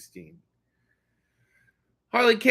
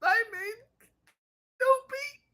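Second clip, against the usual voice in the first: silly Doopy noise; 3 dB quieter than usual. Acted. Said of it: "you make Doopy".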